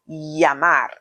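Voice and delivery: llamar with the ll said as y, yamar; Y sound for double L. Shown as a demonstration. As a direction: open llamar with a clear English y. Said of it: "And there's the Y pronunciation".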